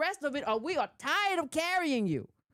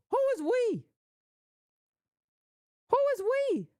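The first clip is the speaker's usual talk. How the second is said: incredulous; 9 semitones higher than usual. Real, not acted. He challenges the Trump supporter, high and incredulous. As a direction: demand it in disbelief.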